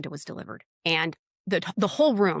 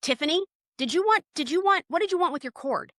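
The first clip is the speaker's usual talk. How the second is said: high faux-innocent; 7 semitones higher than usual. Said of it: "So I'll go".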